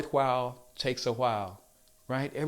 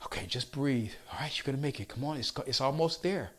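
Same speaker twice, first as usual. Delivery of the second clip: soothing coach; 7 dB quieter than usual. Retold or imitated. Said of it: "So I'm there calming her down".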